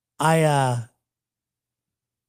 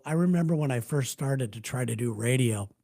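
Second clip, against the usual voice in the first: normal talking voice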